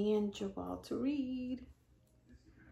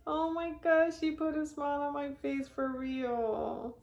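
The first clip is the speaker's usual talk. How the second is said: overjoyed; 7 semitones higher than usual. Real, not acted. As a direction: let the delight lift the voice up. Moved by daughter's gift.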